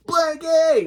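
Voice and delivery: loud, very high shriek; tantrum shriek. Acted. Khloe turned menace demanding blankie, high shriek. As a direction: shriek it like a raging little monster.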